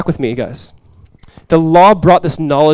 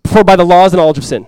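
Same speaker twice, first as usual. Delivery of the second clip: quick reading; 4 semitones higher, 6 dB louder, faster than usual. Shown as a demonstration. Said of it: "Hear that really quick".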